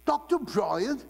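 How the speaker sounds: high-pitched mock South African lilt; South African accent. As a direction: put on a South African accent, voice lifted into a question. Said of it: "they have a beautiful accent".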